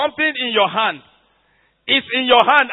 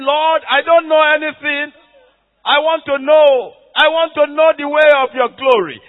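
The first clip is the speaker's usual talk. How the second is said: raised, loud, pleading prayer; fervent prayer; 6 semitones higher, 8 dB louder than usual. Pretend. Preacher voicing a believer's plea to God, loud and fervent. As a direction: cry out to the Lord with longing.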